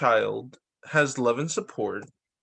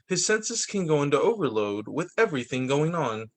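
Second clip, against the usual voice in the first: even, measured reading of a picture-book script; storybook narration; 2 semitones higher than usual. A narrator's reading. Narrator reading the dog ADHD story to viewers, storybook style. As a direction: read it like a gentle explainer book.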